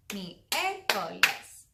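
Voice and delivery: loud, very slow "Miércoles", syllable by syllable; very slow demonstration. Shown as a demonstration. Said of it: "it can be a little tricky".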